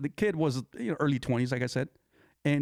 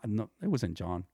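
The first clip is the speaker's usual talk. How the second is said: ordinary delivery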